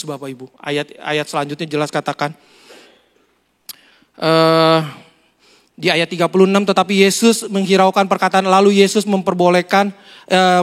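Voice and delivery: usual speaking voice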